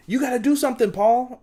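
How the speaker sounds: high, loud mock complaint; urging. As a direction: imitate someone urgently appealing to a parent. Acted onlooker urging bully's parent.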